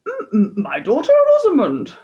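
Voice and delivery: high, loud, stuttering plea; stammering king. A narrator's reading. King pleading with the bad fairy, loud stammering cry. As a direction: blurt it out in a nervous panic. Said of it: "cried the king hopefully".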